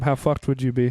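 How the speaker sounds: usual speaking voice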